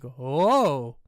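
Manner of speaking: loud, hammed-up startled whoa; exaggerated horror-movie whoa; mock scared whoa. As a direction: gasp out a fake fright. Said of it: "They're like".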